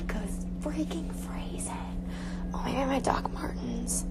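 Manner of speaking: whispered to herself; whispering. Real, not acted. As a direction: mutter it under your breath. Muttering to herself at closet.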